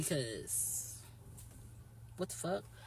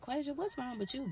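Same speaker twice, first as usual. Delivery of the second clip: higher, sing-song concerned question; high imitated friend; 9 semitones higher than usual. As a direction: mimic a friend's prying high voice.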